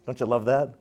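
ordinary delivery

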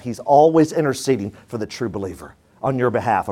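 normal talking voice

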